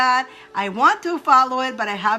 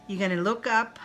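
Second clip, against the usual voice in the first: ordinary talk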